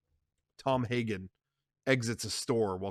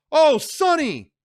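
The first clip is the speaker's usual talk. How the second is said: high loud slow cry; frightened cry; 11 dB louder, slower than usual. Acted. Sandra startled by the crash, calling Sonny. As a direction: cry out in fear.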